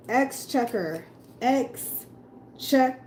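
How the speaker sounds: Exchequer dragged out syllable by syllable; slow careful practice. Shown as a demonstration. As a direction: sound out Exchequer slowly. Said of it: "Three syllables".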